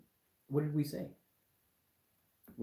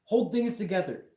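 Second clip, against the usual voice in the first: loud, higher-pitched mock quote; shouted imitation, pitched up; 7 semitones higher, 8 dB louder than usual. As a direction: blurt it out loud like a mocking quote. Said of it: "all you said was".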